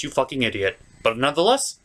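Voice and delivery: ordinary talk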